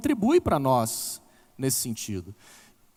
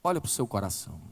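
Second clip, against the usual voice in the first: gentle guidance; 7 semitones lower, 7 dB quieter, faster than usual. Retold or imitated. Pastor guiding her gently.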